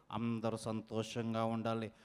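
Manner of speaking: very low, soft well-wishing; health-show host impression. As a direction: wish them well in a deep, hushed host voice. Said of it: "unique mimicry concept". (లోతైన, చాలా మెల్లని mimicry గొంతు)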